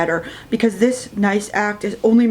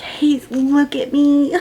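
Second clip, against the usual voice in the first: pleading high; 6 semitones higher, slower than usual. Retold or imitated. Pleading with hurt friend.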